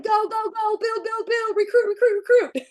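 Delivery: high, loud chant of go, build, recruit; hyped self-talk. Retold or imitated. To herself about recruiting for the bonus, a high loud excited chant. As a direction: pump yourself up like a coach yelling. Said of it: "I'm like".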